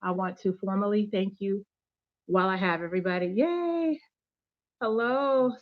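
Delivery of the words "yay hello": high bright yay; excited cheer. Real, not acted. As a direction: cheer like you're thrilled. Cheering viewers joining the live.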